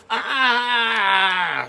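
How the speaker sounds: high, loud shriek of shock. Acted. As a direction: shriek as water hits your face. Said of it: "shrieked Ruby".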